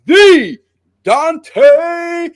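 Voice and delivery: ring announcer intro. Shown as a demonstration. Podcast host introducing wrestling guest with stank, hyped announcer call. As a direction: stretch the name out like a ring announcer hyping a champ. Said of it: "You gotta put some stank on it".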